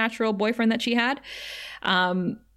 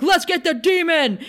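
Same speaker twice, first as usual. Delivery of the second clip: gung-ho Riley; 8 semitones higher, 4 dB louder than usual. Retold or imitated. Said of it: "Riley was like".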